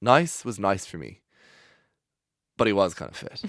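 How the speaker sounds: ordinary talk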